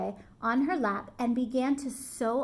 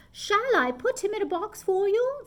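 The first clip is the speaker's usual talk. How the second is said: higher polite saleslady; higher helpful saleslady; 8 semitones higher, 4 dB louder than usual. A narrator's reading. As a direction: offer it sweetly as a clerk. Saleslady voiced.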